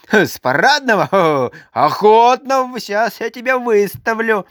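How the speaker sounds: mock him as you grab him; mocking exclaim. A narrator's reading. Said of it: "воскликнула она".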